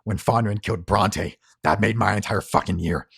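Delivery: loud, building denunciation; angry tirade. Acted. Harlan denounces Baraka at gunpoint, loud anger. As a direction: build from firm to loud fury.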